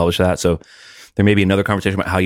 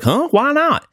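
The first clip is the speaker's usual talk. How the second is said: puzzled; much slower than usual. Retold or imitated. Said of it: "he might be like".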